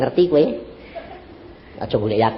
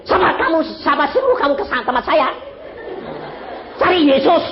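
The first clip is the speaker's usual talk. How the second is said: pastor's retort; 9 semitones higher than usual. Retold or imitated. Pastor retells his reply.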